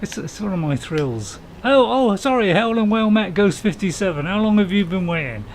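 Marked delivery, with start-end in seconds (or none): 1.6-5.4 loud, high flustered sorry; loud startled apology. Real, not acted. Bumps another driver in game.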